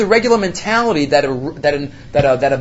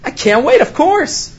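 a higher, hammed-up rush of eagerness; mock eager acceptance; 7 semitones higher, slower than usual. Rabbi mocking the expected answer, hammed-up eager. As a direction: play the overjoyed candidate who can't wait. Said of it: "What should he say?".

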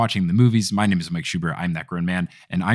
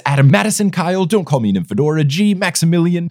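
upbeat, brisk list of names read higher; patron name read; 6 semitones higher, 3 dB louder than usual. Acted. Host thanking patrons, brisk upbeat name reading. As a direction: rattle off the patron names cheerfully.

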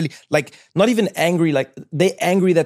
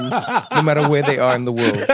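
normal talking voice

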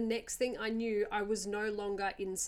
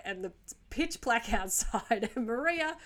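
ordinary talk, nothing marked

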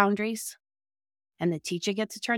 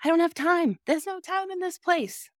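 mock protest in a higher pitch; imitated excuse; 9 semitones higher than usual. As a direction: voice a director's higher-pitched objection, lightly acted. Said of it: "I know what you're gonna say to me".

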